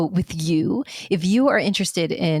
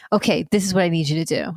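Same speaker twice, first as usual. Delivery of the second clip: ordinary delivery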